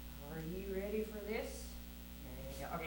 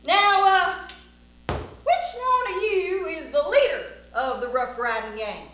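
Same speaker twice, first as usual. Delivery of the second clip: loud, theatrical cowboy demand; bold challenge; 8 semitones higher, 14 dB louder, slower than usual. Acted. Cowboy demands the gang's leader, booming challenge. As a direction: call out the whole crowd.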